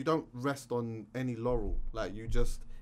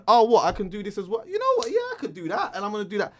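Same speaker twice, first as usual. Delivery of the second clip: much higher and louder, gushing with eagerness; excited imitation; 9 semitones higher, 7 dB louder, faster than usual. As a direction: burst with can-do enthusiasm. Mimicking her eager can-do attitude.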